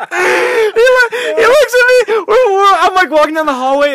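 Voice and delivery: high, loud, gasping through laughter; real hysterical laughter. Real, not acted. Retelling brother's hallway line, helpless with laughter. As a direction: struggle to get the words out while laughing hard.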